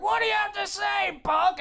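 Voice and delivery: high hollered demand; bellowing boss. Acted. Boss grilling Buck at the interview, hollering. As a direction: bark the question like a tyrant.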